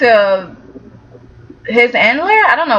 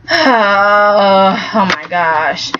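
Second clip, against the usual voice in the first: slow weary groan; 6 semitones lower, much slower than usual. Real, not acted. Sighing at coming drama.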